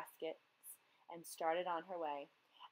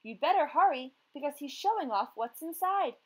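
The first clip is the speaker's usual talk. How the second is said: urgent; 6 semitones higher, 7 dB louder than usual. A narrator's reading. Friend urging her to hurry.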